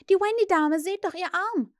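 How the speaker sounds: high, loud excited shout; amazed, urgent cry; calling out. A narrator's reading. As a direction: shout for everyone to look. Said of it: "rief er".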